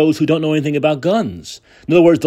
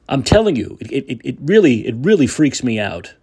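usual speaking voice

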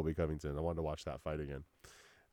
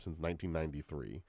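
ordinary delivery

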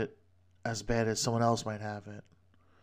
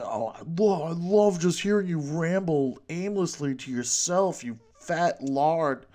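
higher, louder mock gushing turning to insult; mocking fake fan; 5 semitones higher, 5 dB louder than usual. Imagined listener heckling the rambling solo host, mock gushing. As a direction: gush sarcastically in a put-on voice.

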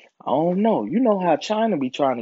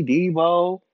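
ordinary talk

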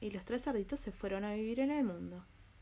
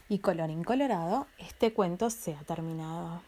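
storybook ending; 3 semitones lower, 5 dB louder than usual. A narrator's reading. Story closing formula.

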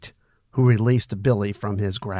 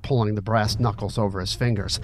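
plain reading voice

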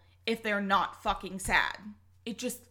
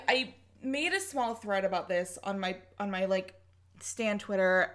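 ordinary talk, nothing marked

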